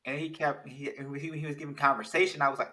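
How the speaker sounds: ordinary talk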